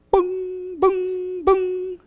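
high sustained beeping "boom"; three high drawn-out alarm beeps; mimicked warning chime. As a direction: hold each "boom" high and slow like an alarm. Imitating tire sensor alarm again.

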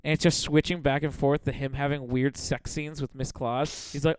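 ordinary talk

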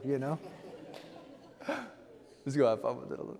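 ordinary delivery

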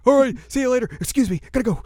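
high, loud, hurried goodbye; scurry off in a squeaky voice; squeaky hasty exit. Retold or imitated. Weasel demon rushing away from Moroku, high and loud.

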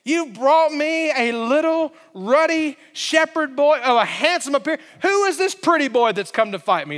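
high mocking taunt; mocking Goliath. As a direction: scoff at the little shepherd from way up. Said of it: "That's what Goliath is saying".

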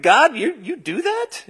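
mock incredulous question. His own retold reaction to God.

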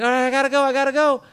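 panicky exit. Retold or imitated. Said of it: "And he's like".

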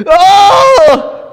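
loud high scream of pain; pained cry. A narrator's reading. Herbert cries out at the deafening din. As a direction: cry out as the noise hits. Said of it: "cried Herbert".

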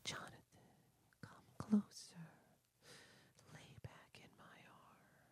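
whispered seductive brides voice; breathy come-hither whisper, luring him in; sultry narrator. Retold or imitated. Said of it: "It was a sultry voice".

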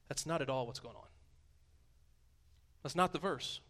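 ordinary delivery

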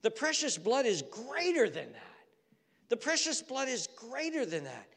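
loud, high proclaiming; full-force preaching. Acted. Preacher proclaiming to the congregation, loud and rising. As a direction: build it up like a pulpit cry.

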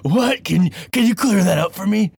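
high, loud puzzled question; mock confusion. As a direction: play the baffled city slicker.

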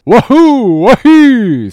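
very high, very loud long whoop; screamed war whoop. A narrator's reading. Harpooner in reply to Stubb, screamed war whoop.

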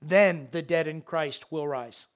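ordinary delivery

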